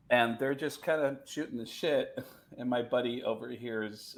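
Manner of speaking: ordinary talk, nothing marked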